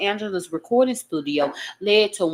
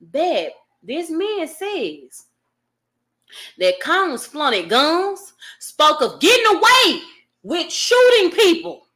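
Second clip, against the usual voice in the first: emphatic slow reread; slow pointed reread; 5 semitones higher, 4 dB louder, slower than usual. Shown as a demonstration. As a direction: read it slow, then louder. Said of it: "let me read it to y'all again".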